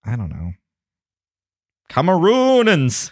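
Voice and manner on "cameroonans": Cameroonans drawn out high and slow; mock pronunciation. Shown as a demonstration. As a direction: stretch Cameroonans slowly, pitched up. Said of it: "You gotta say it like that".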